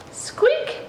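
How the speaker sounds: tiny high mouse squeak; high-pitched squeak of a mouse. A narrator's reading.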